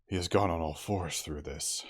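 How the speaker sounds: out of breath, loud; panting. Acted. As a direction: gasp it out while running. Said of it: "panted Moreau".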